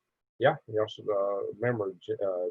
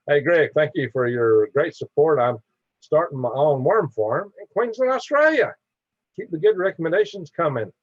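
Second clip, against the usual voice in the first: louder, higher, eager read of a fan comment; reading a viewer's thank-you, pitched up with excitement; excited chat reading; 6 semitones higher, 6 dB louder than usual. Real, not acted. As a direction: read it out like great news. Reads viewer comment aloud enthusiastically.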